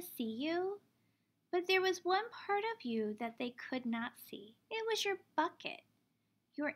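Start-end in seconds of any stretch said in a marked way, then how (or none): none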